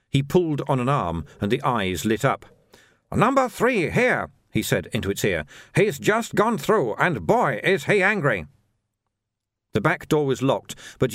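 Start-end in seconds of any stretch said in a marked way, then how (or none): 3.1-4.3 high, slow shopkeeper voice; little shopkeeper reporting. A narrator's reading. Shopkeeper reports into a dummy's ear, high accented voice. 5.8-8.5 high, slow shopkeeper voice; little shopkeeper reporting. A narrator's reading. Shopkeeper reports into a dummy's ear, high accented voice.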